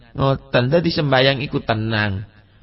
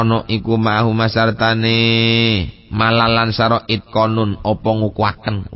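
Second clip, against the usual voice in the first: kitab recitation; 4 semitones lower than usual. Acted. Preacher reading the kitab word by word to the congregation, monotone recitation. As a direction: intone the Arabic and its Javanese gloss evenly. Reads the kitab with Javanese gloss.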